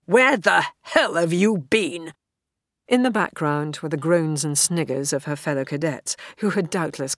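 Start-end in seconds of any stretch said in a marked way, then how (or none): 0.1-2.1 loud, slow, exasperated demand. A narrator's reading. Officer demanding where the late cadet was, exasperated. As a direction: drag out each word in loud exasperation. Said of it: "exasperation in his voice was palpable".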